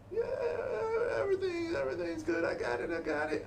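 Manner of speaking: squeaky high, strained, speeding up at the end; overeager "everything's good". Shown as a demonstration. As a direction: oversell it nervously. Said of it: "If you tell me / I don't feel like you got it".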